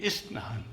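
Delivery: soft, low 'is-naan' for स्नान; joined 'is' sound. Shown as a demonstration. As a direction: say स्नान softly with a short i in front. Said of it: "ये क्या बन गया?".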